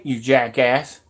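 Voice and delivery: ordinary delivery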